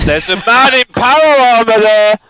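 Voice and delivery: loud alarmed call; excited shout. Real, not acted. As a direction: call out the danger.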